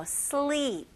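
"sleep" with a stretched "ee"; long vowel. Shown as a demonstration. Teacher giving another example word, long "sleep". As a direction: draw out the "ee" in "sleep". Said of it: "이것도 똑같아요".

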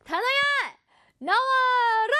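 높은 목소리로 단어를 불러내듯; 마법 주문처럼 힘차게 외쳐; 신나게 부르기. Pretend. 단어를 부르는 외침. (단어를 불러내는 신나는 외침)

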